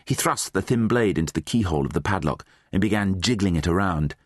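usual speaking voice

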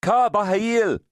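high, carrying shout across a field; calling out. A narrator's reading. Brother-in-law hailing Diti from the next field, calling out. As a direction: call out loudly, pitched right up. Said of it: "he called out".

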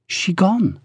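put-on high accented woman's voice; voiced young woman. A narrator's reading. Ruby checking Pearl has left, high voice. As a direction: pitch it up for the woman.

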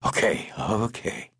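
slow, sighed concession; sighing resignation. A narrator's reading. Chauffeur conceding the point to his employer, resigned. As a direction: give in slowly with a sigh. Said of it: "he sighed and nodded".

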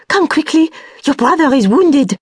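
high, loud frantic call; crying out in alarm. A narrator's reading. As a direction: burst in shouting the bad news. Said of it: "crying out".

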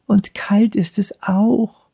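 put-on slow, frightened child's whisper; scared whisper. A narrator's reading. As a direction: whisper it trembling with fear. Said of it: "Olli schlotterte vor Angst".

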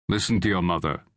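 plain reading voice

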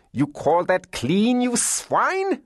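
high, slow domineering hostess, rising; drawn-out, outraged demand; scolding cry. A narrator's reading. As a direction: berate him in a high, imperious voice. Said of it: "she cried".

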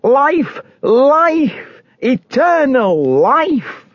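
high, drawn-out desperate cry; impassioned high call, stretching the last words. A narrator's reading. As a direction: call it out high as you flee for your soul.

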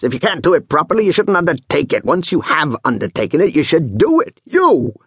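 high, bossy scolding; hectoring, high-pitched telling-off; commanding. A narrator's reading. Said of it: "he commands".

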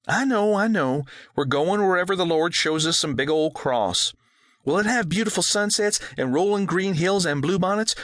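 put-on high girl's voice full of hope; dreamy daughter pleading sweetly; hopeful little girl. A narrator's reading.

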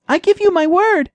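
high little mouse voice, earnest; the mouse promises the cat she is no trick; promising mouse. A narrator's reading. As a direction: swear it sincerely as the tiny mouse. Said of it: "Jenna promises".

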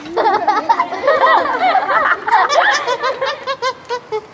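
very high squealing giggle; squealing laugh. Real, not acted. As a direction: shriek with laughter. Laugh after monkey joke.

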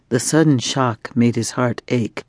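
usual speaking voice

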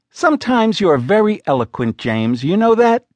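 a lightly voiced wife needling with irony; sarcastic. A narrator's reading. Wife mocking James's crude words, sarcastic. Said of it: "she was being sarcastic".

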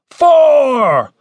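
high, loud drawn-out count; shouted countdown. A narrator's reading. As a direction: bellow the number from outside. Commander shouts countdown from outside.

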